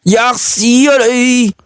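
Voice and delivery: slow, high, spat-out insult; contemptual curse. A narrator's reading. Zhang Yi curses Wang Xing before hanging up, slow and high. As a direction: throw the insult at him.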